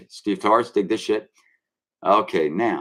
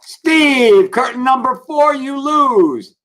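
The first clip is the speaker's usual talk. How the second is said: loud, high game show announcer; game show host; 12 semitones higher, 6 dB louder, much slower than usual. Pretend. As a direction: announce his loss like a quiz host. Mocking game show loser call.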